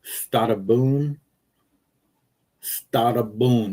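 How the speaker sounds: slow Italian-style stata boon with long boon; mock Italian goodbye. Shown as a demonstration. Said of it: "You gotta say it like an Italian".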